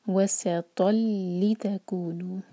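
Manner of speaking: slowly recited so the ता stays heavy; slow recitation. Shown as a demonstration. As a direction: recite वसतल लितकूनु slowly. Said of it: "धीरे धीरे पढ़ें".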